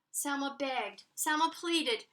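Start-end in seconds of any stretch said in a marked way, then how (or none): none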